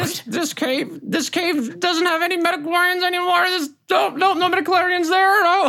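very high-pitched, babbling character voice; flustered droid navigator. Acted. Mira reporting no midichlorians in the cave, high flustered voice. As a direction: stammer your findings high and helpless.

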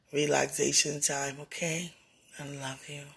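normal talking voice

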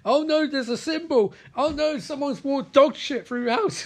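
high-pitched exaggerated fretting; mock dismay. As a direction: fret in a put-on high voice. Imitating Abby's dismay.

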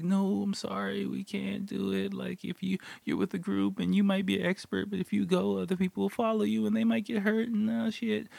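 sustained high imitation of an apologetic woman; lady refusing. Staff lady explaining to hiker why not, high-pitched imitation.

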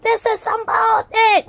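high, slow disgusted outburst; fed up. Retold or imitated. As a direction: spit out the frustration. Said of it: "I wasn't saying to my husband it was his fault".

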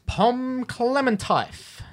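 "Pom Clementife" sounded out slowly and high, unsure; unsure pronunciation. Real, not acted. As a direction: stretch out "Pom Clementife" as if guessing the spelling. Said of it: "How you pronounce that?".

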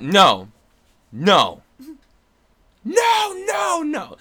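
firm no's building to a high shout; emphatic refusal. Acted. Actor talking himself out of self-doubt, mock shouted protest. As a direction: escalate into a hammed-up scream of refusal. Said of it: "And then I thought".

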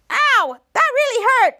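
high, loud mock yelp of hurt; acted pain. Toddler just hit in the fight, loud pained complaint. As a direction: cry out as if just struck.

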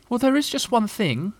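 high, hesitant little animal voice; fidgety Piglet. A narrator's reading. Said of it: "fidgeting a bit".

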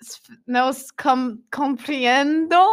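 slow, stumbling attempt at Spanish; halting beginner Spanish. Retelling today's Spanish class, halting beginner attempt. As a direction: grope for each Spanish word, stretching the last. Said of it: "I barely know how to Spanish".

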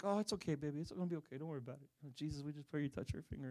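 put-on softly hushed, comforting a hurt child; calm her down gently, almost a murmur; soothing. Parents soothing injured daughter.

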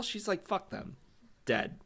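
usual speaking voice

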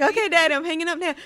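high, loud mock-impatient daughter; playful phone goodbye. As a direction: sing out a hurried goodbye to dad. Acting out phone goodbye with dad.

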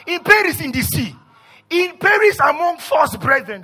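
high, loud preacher's recital; rousing pulpit reading at full force; forceful scripture reading. Acted. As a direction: pound out each item of the list. Reads scripture list at full force.

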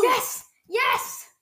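high triumphant shriek; shrieking jackpot winner. Real, not acted. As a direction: cheer like you hit the jackpot. Winning ace war, cheering.

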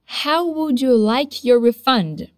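slow and even, stress on how; slow drill. Shown as a demonstration. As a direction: model it slowly for the learners. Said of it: "One more time".

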